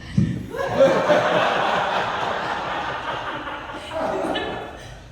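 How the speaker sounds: long breathy laugh; cracking up; real laughter. Real, not acted. Laughing after apology.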